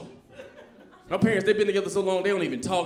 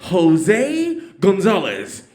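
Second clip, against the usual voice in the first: slow, louder stretched name call; 7 dB louder, much slower than usual. Retold or imitated. Announcer calls graduates' names, slow and drawn out. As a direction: draw each name out over the PA.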